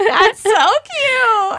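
high, loud, drawn-out squeal with laughter; real delighted squeal over something adorable; gushing delight. Real, not acted. Gushing over listener's family photos.

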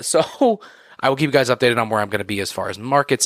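ordinary talk

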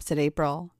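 usual speaking voice

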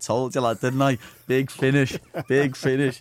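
triumphant, loud retold boast; imitated crowing. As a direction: mimic a mate crowing loudly. Mate crowing after the fight.

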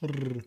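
Rrr with a trilled tongue r; rolled r. Shown as a demonstration. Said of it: "Can you roll your R's?".

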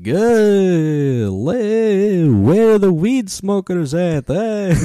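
big hammed-up vampire emcee; Count Hacula. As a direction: hack comic vampire, high, loud and drawn out. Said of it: "dumb hack shit in a vampire voice".

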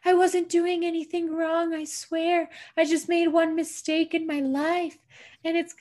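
high-pitched, hammed-up tearful pleading; mock crying. Man caught hiding breaks down, mock crying. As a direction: imitate a man wailing his excuse. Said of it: "starts crying, and is like".

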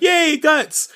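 high, loud cheer for a hero; playful high-pitched hooray; mock crowd cheer. Everybody cheering for Guts.

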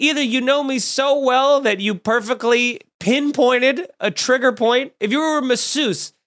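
usual speaking voice

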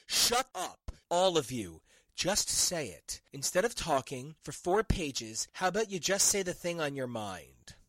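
ordinary talk

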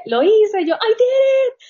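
high, loud triumphant yelling; woozy post-op yell of victory; retold shout. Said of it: "Yo empecé a gritar en inglés".

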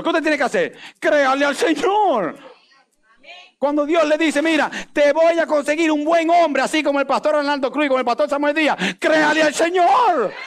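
rising revival preacher call; full-force preaching. Real, not acted. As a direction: drive it home to the congregation. Preacher builds to climax.

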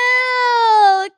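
high, drawn-out mock croon. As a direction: stretch the word out high and silly. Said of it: "[name]'s like".

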